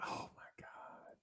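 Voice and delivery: slow breathless whisper; hushed mock disbelief; stunned whisper. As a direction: whisper it in shock. Stunned reaction after friend leaves.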